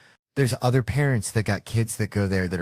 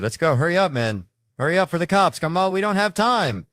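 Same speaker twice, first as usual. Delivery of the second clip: high, loud panicked hurry; building panic, getting higher and louder; frantic urging; 9 semitones higher, 5 dB louder than usual. Retold or imitated. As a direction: rush them out before the cops come. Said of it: "Then he snaps on me".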